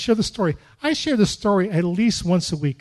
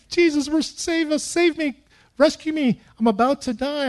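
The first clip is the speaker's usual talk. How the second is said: panicked prayer; 7 semitones higher than usual. Retold or imitated. Praying in panic for rescue.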